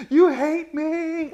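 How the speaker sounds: very high, loud, drawn-out mock wail; oversensitive little girl. Grandpa mimicking little girls told to clean their room, hammed-up wail. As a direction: wail it like a wounded child. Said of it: "God, they're so sensitive".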